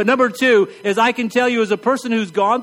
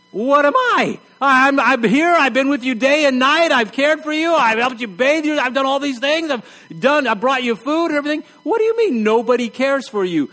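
indignant caregivers; 5 semitones higher than usual. Retold or imitated. Household answering the complaining sufferer, mock indignant. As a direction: play the hurt helper listing all they did. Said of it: "everybody in the house is like".

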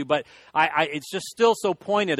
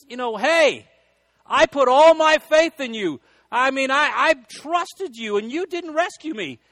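complaining to God; 9 semitones higher, 6 dB louder than usual. Retold or imitated. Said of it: "he goes".

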